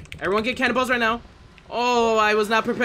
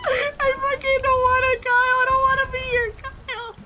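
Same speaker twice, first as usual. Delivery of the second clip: 1 whiny scared pleading. Real, not acted. Scared gamer pleading during Kraken attack.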